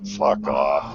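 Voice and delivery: low, slow retold brush-off; dismissive brush-off. Man waving off his deluded buddy, dismissive. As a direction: wave him away with a slow, low mutter. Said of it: "I just waved him off".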